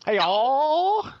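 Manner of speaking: high drawn Hey, y'all; Southern twang greeting; Texas accent. Pretend.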